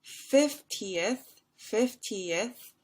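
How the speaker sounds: "fiftieth" slowly, sounding out fif-ti-eth; three-syllable fiftieth. Shown as a demonstration. Teacher contrasting with fifteenth, "fiftieth" in three slow syllables. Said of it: "has three".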